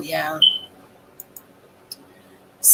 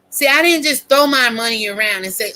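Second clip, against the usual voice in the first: usual speaking voice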